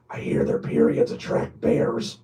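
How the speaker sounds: low-pitched film-comedy voice; Steve Carell. Retold or imitated. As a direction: do a comic movie-line impression, voice dropped low. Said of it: "where Steve Carell was like".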